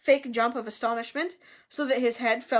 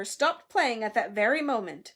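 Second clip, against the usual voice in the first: ordinary delivery, nothing marked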